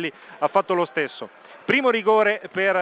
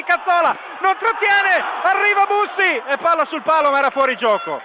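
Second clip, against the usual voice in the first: sudden loud rise at the chance; excited commentary; 8 semitones higher, 4 dB louder than usual. Real, not acted. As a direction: shout as the ball hits the post. Near goal in live commentary. (telecronaca concitata, voce alta)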